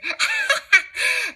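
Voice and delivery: high villainous cackle; devilish cackle. Acted. Cruella cackles over her fur coat plan, high devilish cackle. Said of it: "Cruella's devilish grin".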